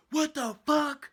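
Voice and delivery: loud, high, drawn-out yell of disbelief; shocked shout. Retold or imitated. Friend opens the door on the scratching teller, shocked shout. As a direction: act out a friend yelling in shock.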